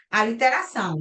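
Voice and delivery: normal talking voice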